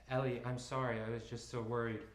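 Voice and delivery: usual speaking voice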